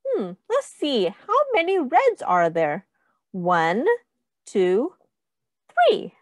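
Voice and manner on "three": excited reveal. Shown as a demonstration. Counting climax, big high finish.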